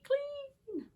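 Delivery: high squeak on clean; squeaky high. Acted.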